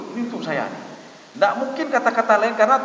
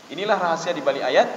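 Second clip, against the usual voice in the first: ordinary delivery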